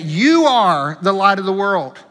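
emphatic declaration with a strong opening "You"; emphatic statement. Retold or imitated. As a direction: punch "You are", then let the pitch fall. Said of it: "It's a very emphatic statement".

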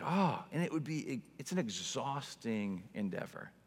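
normal talking voice

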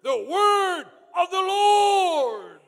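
very high, loud, drawn-out shout; thunderous prophetic command; bold proclamation. Shown as a demonstration. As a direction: cry it out boldly, stretching each word. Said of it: "boldness and say to them".

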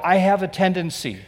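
ordinary talk, nothing marked

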